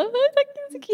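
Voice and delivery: high-pitched mock fluster; squeaky-high comic agitation. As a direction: act out a flustered little panic, pitch shooting up. Flustered panic when child appears.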